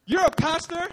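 shocked disbelief. Retold or imitated. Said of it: "They were just so shocked".

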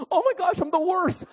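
very high-pitched self-berating wail; mock despair. Golfer after a bad shot, in mock despair.

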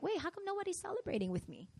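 small, high, soft voice; quietly puzzled and let down; acted bewilderment. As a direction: play a student left hanging, softly. Acting student's let-down bewilderment.

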